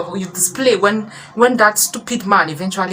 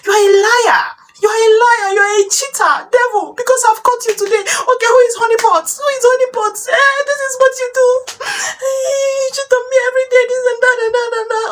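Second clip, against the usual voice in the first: very high, loud hammed-up tirade; enraged wife; 4 dB louder, faster than usual. Wife calling her husband a liar, shrieking melodrama. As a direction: shriek accusations, speeding into a rant.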